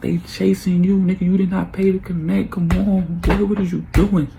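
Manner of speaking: high, exasperated telling-off; retold scolding. Man scolds his cousin about the chase, exasperated. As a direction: plead with him to see sense.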